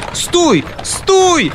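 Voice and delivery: very high, loud wail; desperate yell. Acted. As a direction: scream after the carriage in despair. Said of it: "отчаянный вопль".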